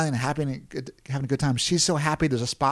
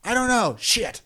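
higher and louder, fretful worrying; anxious; 8 semitones higher, 4 dB louder than usual. Retold or imitated. Trainer worries how Koreans will respond, anxious. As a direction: play his nervous rant about rules. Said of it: "'cause he was like".

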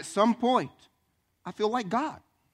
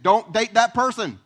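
parents' warning; 4 semitones higher, 9 dB louder, slower than usual. Retold or imitated. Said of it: "Your parents said".

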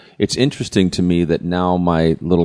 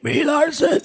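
squeaky helium voice, much higher; slower than usual. Pretend. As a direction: talk like you sucked helium underwater. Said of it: "do Brie Larson underwater on helium".

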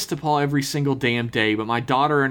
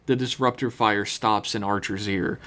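ordinary talk

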